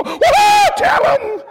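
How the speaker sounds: high, loud cheering amen; saved folks hollering. Retold or imitated. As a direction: egg the preacher on like the faithful. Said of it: "all the saved folks go".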